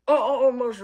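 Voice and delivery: high, loud wailing cry; frantic wail for Mercy; panicked cry. Pretend. Character panics loudly.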